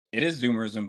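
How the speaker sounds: ordinary delivery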